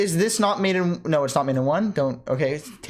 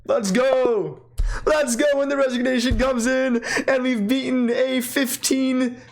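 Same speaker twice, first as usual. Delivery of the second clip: excited cheer; 8 semitones higher, slower than usual. Real, not acted. Winning chess game, celebrating.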